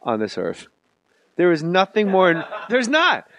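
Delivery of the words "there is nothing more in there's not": raised, higher, building rant; comic rant. Real, not acted. Ranting to his guest about hot chicks, heated. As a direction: rail against them in mock outrage.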